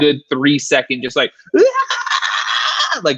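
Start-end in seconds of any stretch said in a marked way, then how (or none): 1.6-3.0 high, piercing imitation of a woman screaming; imitated scream. Host imitates the ghost scream for guests, high blood-curdling. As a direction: let out a blood-curdling scream to show what was caught. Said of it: "blood curdling scream".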